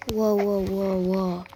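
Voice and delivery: ordinary talk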